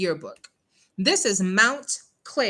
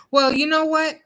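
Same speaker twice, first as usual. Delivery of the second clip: ordinary talk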